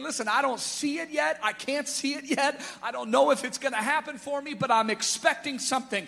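loud, building declaration of hope; beggar's rising cry. Acted. Beggar throwing off his garment, building loud declaration.

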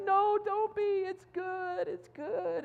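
very high, squeaky imitated weeping; mock crying. Rebecca comforting Keeley, imitated happy crying. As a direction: play-act reassuring someone through tears. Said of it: "she's crying".

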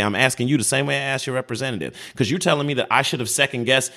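ordinary talk, nothing marked

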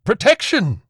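loud high bark. A narrator's reading. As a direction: bark the word out sharply. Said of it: "the priest barked".

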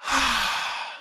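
long calming breath out; deep sigh. Shown as a demonstration. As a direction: breathe out slowly to calm down. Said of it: "let's take a big, deep breath".

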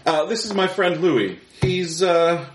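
a touch of a cheerful husband introducing a guest; husband's voice. Acted. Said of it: "he goes".

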